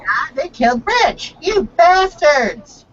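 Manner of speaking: ऊँची आवाज़ में नकली गुस्से की चीख; You bastards को धीरे और ऊँचा खींचो; mock outraged cry. Quoting the famous cartoon outcry.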